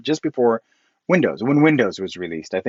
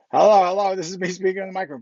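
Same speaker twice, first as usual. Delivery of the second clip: higher, quick, eager hello into the mic; excited demo; 8 semitones higher, faster than usual. Retold or imitated. Showing Charlie the voice recording, excited and quick. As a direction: show off the trick to your friend. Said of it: "Watch this".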